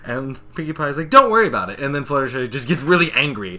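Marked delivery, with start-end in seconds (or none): 1.1-1.8 high, loud brush-off; breezy pony reassurance. Retold or imitated. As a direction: play a cartoon pony waving away worries. Pony brushing off concern, acted.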